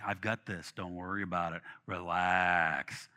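soft, low and calm; lightly voiced God, soothing; reassuring God. Pastor voicing God calming his worry, softly reassuring.

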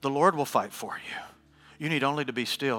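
soft, low, near-whispered verse; hushed scripture. Acted. Preacher reciting Exodus to the powerless, hushed and low. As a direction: let the promise settle in stillness.

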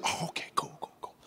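quick hushed, whispery okay; hushed eager okay. Retold or imitated. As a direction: breathe it out, barely voiced. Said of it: "She's like".

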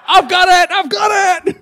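very high, loud shout; comic full-voice holler; eager insistence. Retold or imitated. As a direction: shout it like an over-keen dad grabbing the load. Acting out eager burden carrier.

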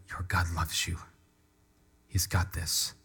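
quiet, tender comfort; gentle reassurance. Real, not acted. Comforting anxious young people.

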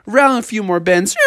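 high, rolling storyteller list; excited ride narration. A narrator's reading. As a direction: carry the listener along the ride. Narrating the ride's twists.

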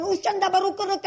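loud, high burst of tongues; ecstatic tongues. Retold or imitated. Maria rising up after prayer, ecstatic tongues. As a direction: cry out fervently in imitated tongues.

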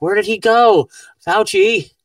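high, loud plaintive call; hammed-up longing, pitched high; mock pining. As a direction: call out as if for a lost friend. Pleading mock search for Fauci.

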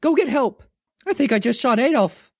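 a touch of panic, calling for help; panicked call. Acted. David calling the dog for help, panicked.

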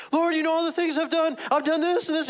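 high, loud pleading outburst; grandma going on. Retold or imitated. Grandma confessing her sins to the Lord, going on and on. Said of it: "so she goes on and on".

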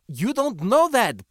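high, loud, agitated objection; spouted retort. A narrator's reading. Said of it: "Benley spouted".

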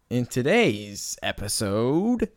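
normal talking voice

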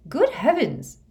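high, loud startled gasp of words; shocked exclamation. A narrator's reading. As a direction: cry out in astonishment. McGonagall stops dead, staring.

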